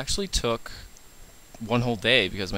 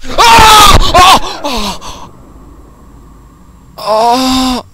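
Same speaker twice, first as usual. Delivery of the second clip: high, loud shocked yells; startled screams; 12 semitones higher, 17 dB louder, much slower than usual. Real, not acted. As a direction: scream at the jump scare. Jump scare in horror game.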